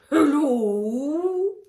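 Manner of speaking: high, loud drawn-out whale call. Retold or imitated. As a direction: stretch the hello like a whale. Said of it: "You know, like Unfinding Nemo".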